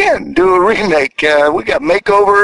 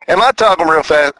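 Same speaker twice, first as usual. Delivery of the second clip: ordinary delivery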